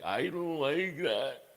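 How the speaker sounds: a dad lightly voicing his upset eleven-year-old son; imitating his son. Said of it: "he had, he".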